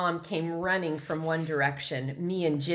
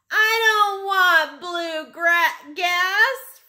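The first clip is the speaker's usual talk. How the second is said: much higher, louder, slow protesting wail; child's wail; 13 semitones higher, 7 dB louder, much slower than usual. Acted. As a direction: cry it out like a frightened little boy. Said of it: "Fudge cried".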